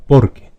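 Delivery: "Porque" as POR-que, first syllable punched; stress on the first syllable. Shown as a demonstration. Teacher showing students the pronunciation, first-syllable stress on "Porque". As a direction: say "Porque" with weight on POR, letting que drop. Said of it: "fíjate bien cómo es la pronunciación".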